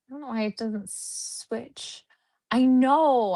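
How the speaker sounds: usual speaking voice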